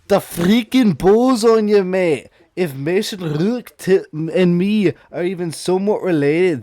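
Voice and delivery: put-on loud, cheeky Scottish accent; brash Glasgow-style Scottish accent; Piper Niven Scottish accent. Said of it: "I'm gonna do it in a Scottish accent".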